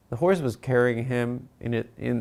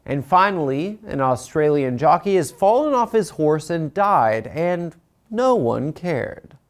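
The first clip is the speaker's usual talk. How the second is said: monologue joke with a drawn-out last line; joke read; 3 semitones higher, 4 dB louder than usual. Acted. Host rehearsing the jockey item for his producer, joke read. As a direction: stretch out the punchline slowly. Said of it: "You didn't read it like a joke".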